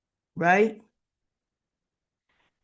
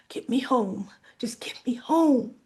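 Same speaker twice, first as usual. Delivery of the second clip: hoarse unvoiced whisper; breathless whisper. Peaches, out of breath after coughing, whispering. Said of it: "catches her breath".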